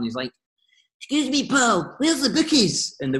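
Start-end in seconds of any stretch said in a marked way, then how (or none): 1.0-2.9 high, squeaky put-on silly voice; a cheeky mate's silly squeaky voice. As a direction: pitch it way up and play the daft joker. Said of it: "he always used to put this silly voice on".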